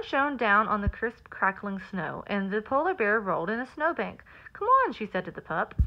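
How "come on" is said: playful call. Acted. Bear invites pup to play.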